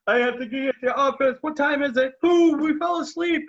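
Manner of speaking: a man realising he's late, rising in alarm; panicked rush. Retold or imitated. Christopher Robin wakes late, voice rising in mock panic.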